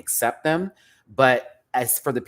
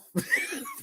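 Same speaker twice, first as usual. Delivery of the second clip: high squeal of laughter; 5 dB quieter than usual. Real, not acted. Cracking up at the episode.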